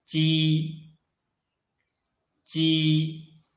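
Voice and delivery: "鸡" held long on a level pitch; initial sound demo. Shown as a demonstration. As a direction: sound out "鸡" evenly for learners. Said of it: "声母 initial is".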